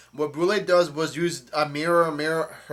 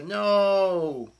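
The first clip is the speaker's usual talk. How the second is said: long, higher-pitched "no"; mock cry of no; 5 semitones higher, 3 dB louder than usual. Retelling trapped Carrot refusing to believe it, drawn-out high mock cry. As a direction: draw out an imitated protest.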